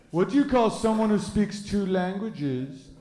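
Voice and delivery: imitated Frenchman's accent telling a joke; mock French accent. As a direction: pose it slowly in a French accent. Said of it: "this one French guy".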